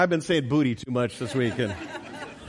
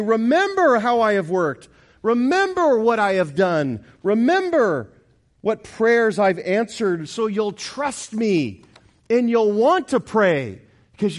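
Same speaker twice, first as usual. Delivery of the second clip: loud, ringing preacher voice speaking as God; preaching at full force; 6 semitones higher, 6 dB louder than usual. Acted. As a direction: proclaim it to the whole church. Preacher voicing God's words.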